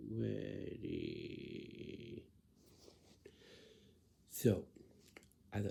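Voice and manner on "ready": very low, drawn-out lazy mumble. Retold or imitated. Adult showing someone how lazy they sound, lazy low drone. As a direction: say it lazily, voice sunk right down. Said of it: "You sound like this".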